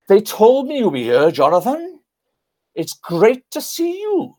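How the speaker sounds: high, sing-song imitated Welsh accent; Trevor Bray. Veteran greets Jonathan at Normandy, imitated Welsh accent. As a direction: put on a delighted Welsh greeting, pitched right up. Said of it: "he said in his Welsh accent".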